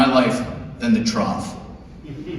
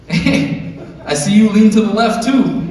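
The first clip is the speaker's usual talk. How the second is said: chatty Chad; 6 semitones higher than usual. Retold or imitated. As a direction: play the overfriendly bathroom bro. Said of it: "some chatty Chad would come up".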